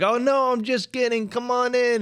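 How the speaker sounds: high, sugary mock invitation; hammed-up innocent father; luring dad. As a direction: lure him in sweetly.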